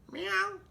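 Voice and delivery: very high cat meow; high kitty meow. Acted. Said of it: "said Cat".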